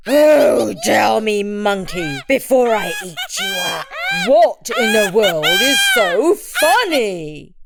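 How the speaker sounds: loud, high-swinging angry roar; roaring tiger. A narrator's reading. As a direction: roar the question at him. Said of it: "the tiger roared".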